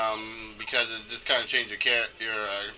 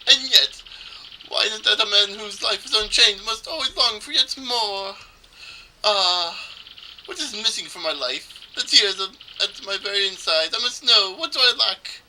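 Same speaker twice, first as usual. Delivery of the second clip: put-on melodramatic Italian-accented trader, pitched up; bad Italian accent; 8 semitones higher, 4 dB louder than usual. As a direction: lament grandly in a shaky attempt at Italian. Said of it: "supposed to do an Italian accent".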